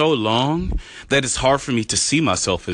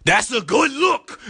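much higher, slow and drawn out; high excited voice; slower than usual. Pretend. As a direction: stretch it out, rising with delight. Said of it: "Hopefully I scared you right now".

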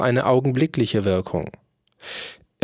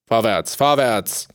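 impatient. A narrator's reading. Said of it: "sagte der König ungeduldig".

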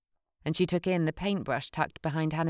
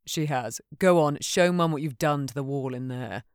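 derisive; 2 semitones lower, 4 dB louder than usual. Acted. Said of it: "Nico snorted derisively".

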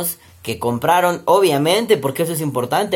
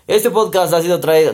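announcer voice; 3 semitones higher, 4 dB louder than usual. Shown as a demonstration. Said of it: "No fue como".

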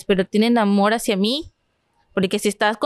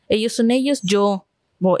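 usual speaking voice

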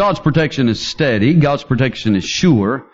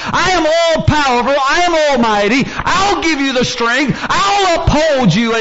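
fervent pulpit shout; full preaching; 10 semitones higher than usual. Acted. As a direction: drive it home to the congregation.

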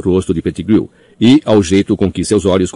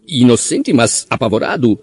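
squeaking; 5 semitones higher than usual. A narrator's reading. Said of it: "guinchou Pettigrew".

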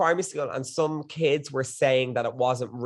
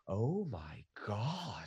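hushed shock; 6 semitones lower, 13 dB quieter, much slower than usual. Retold or imitated. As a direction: draw it out under the breath, imitating stunned disbelief. Retells stunned reaction to Irish voices.

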